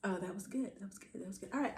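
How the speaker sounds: ordinary delivery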